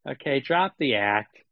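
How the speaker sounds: ordinary delivery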